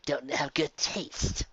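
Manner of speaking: ordinary talk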